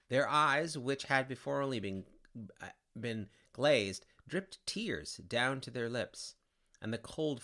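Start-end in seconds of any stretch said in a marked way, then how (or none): none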